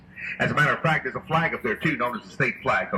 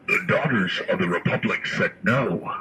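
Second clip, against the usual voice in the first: deep, slow, solemn voice; stern old society voice; 9 semitones lower than usual. Acted. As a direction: voice the old ladies saying no. Guide voicing historical society.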